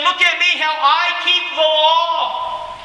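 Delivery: high, proud preening voice, showing off; boastful legalist. Retold or imitated. Preacher mocking legalists who boast of their law-keeping, high and proud. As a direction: strut like a self-righteous legalist. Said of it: "The legalists were saying".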